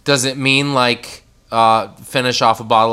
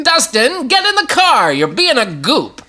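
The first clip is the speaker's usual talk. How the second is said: high, hammed-up old-timey call; 11 semitones higher than usual. Host gives Dustin the slang sentence, old-timey voice. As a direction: scold like a character from an old picture. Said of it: "In an old-timey voice".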